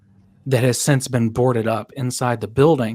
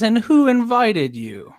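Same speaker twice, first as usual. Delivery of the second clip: higher, slow, pointed question; challenging; 9 semitones higher, slower than usual. Acted. Second worker challenging an uninvited newcomer, slow and pointed. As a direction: draw the challenge out at an intruder. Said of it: "Another guy says".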